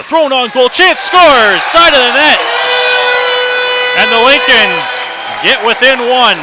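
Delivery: roaring goal call, pitch soaring high and loud. Real, not acted. Announcer calling the Lincolns goal to listeners, roaring excitement. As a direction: explode with the goal, full-throated.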